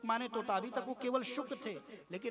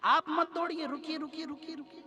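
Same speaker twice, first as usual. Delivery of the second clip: high, urgent calls to halt; trees calling out; 8 semitones higher, 5 dB louder than usual. Acted. Trees calling Vyas ji to stop running, urgent high plea. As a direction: cry out to stop, high and insistent. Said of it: "वृक्षों ने कहा होगा".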